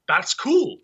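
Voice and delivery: high, loud mock awe; impressed fan. Fan seeing huge letters in a stadium, loudly impressed. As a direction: imitate being impressed, pitched right up. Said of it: "You're like".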